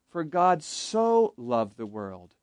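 "so" stretched out as the key word; slow down and lean on "so"; stress on so. Shown as a demonstration. Teacher demonstrating emphasis in a verse, stress on "so". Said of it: "emphasize different words".

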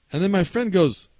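ordinary talk

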